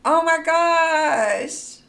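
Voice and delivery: high, loud, near tears of joy; real overexcited squeal. Real, not acted. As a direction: gush as if about to cry with joy. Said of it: "I'm too excited".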